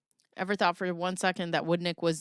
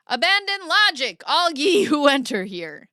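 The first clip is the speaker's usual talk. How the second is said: higher, louder, grandly declaimed; mock grand proclamation; 6 semitones higher, 6 dB louder than usual. Joking about the court, grand mock proclamation. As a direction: proclaim it like a sign at hell's gate. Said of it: "mock proclamation".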